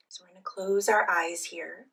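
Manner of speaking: soft, unhurried phrases with long pauses; guided meditation. Real, not acted. Leads guided breathing meditation.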